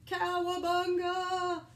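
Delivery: loud, slow, high surfer yell; hammed-up stretched-out whoop; cowabunga. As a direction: shout it leaping into a puddle. Crayon jumps in puddle.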